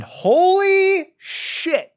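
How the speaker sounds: very high, drawn-out slow holy shit; drawn-out awed exclamation; awestruck. Real, not acted. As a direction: stretch it out in pure amazement. Raving about a movie.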